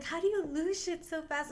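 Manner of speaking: high-pitched mock scolding; mock mad friend. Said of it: "she was so mad".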